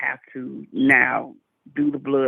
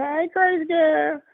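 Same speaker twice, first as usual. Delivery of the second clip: playful greeting; 11 semitones higher, slower than usual. Real, not acted. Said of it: "greeting a viewer".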